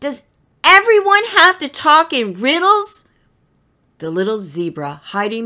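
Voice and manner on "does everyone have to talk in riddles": much higher and louder exasperated cry; frustrated outburst. Acted. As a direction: stamp and protest at all the riddles. Stamps feet in frustration.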